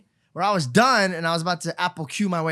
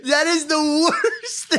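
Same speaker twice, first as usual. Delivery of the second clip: high, slow words through laughter; laughing disbelief; 9 semitones higher, much slower than usual. Real, not acted. Telling friends the grandpa story, laughing through it.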